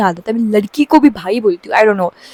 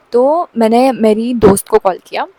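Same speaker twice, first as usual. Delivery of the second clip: normal talking voice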